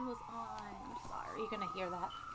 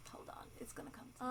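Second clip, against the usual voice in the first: whisper to herself; 4 semitones higher, 9 dB quieter, faster than usual. Real, not acted. Trying to remember her point.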